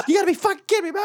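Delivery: fast, high-pitched mock rant; hammed-up, rushed exasperation; retold angry stage. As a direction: garble it into a squawking fit of outrage. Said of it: "all the stages of grief".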